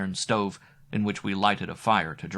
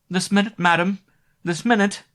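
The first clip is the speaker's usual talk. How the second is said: high, loud and hurried in a fright; frightened cry; 12 semitones higher, 7 dB louder than usual. Acted. The young maid calling down to her mistress in a fright. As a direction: call back anxiously, jumping up. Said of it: "cried the poor child".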